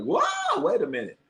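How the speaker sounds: whoa leaping way up in pitch, then wait a minute dropping back; spooked realization; retelling a jump-scare reaction; startled whoa. Retold or imitated. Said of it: "That's one time movie I was like".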